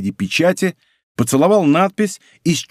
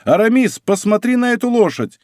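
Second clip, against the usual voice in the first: high, excited call to a friend; delighted cry, pitch held high; joyful astonishment; 8 semitones higher than usual. A narrator's reading. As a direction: urge him to look, amazed. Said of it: "вскричал он".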